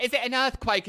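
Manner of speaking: put-on high, loud alarmed child; alarmed kids. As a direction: cry out in alarm like a kid. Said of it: "The kids are like".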